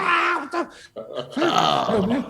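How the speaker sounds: very high, drawn-out shocked cry; mock horror. Seeing snakes under his skin, horrified. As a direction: act out recoiling in horror. Retold horror at writhing stomach.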